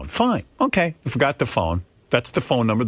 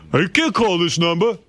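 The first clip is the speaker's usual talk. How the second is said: higher, louder dismissive mechanic; shitty attitude; 6 semitones higher, 3 dB louder than usual. Retold or imitated. Mechanic refusing the wife's request, snotty and dismissive. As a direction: sneer it at her like a rude grease monkey. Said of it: "giving her a really shitty attitude".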